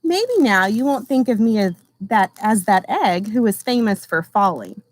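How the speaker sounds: gentle storybook reading voice; reading to children. Acted. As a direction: read it like a picture book for a class. Teacher reads picture book aloud.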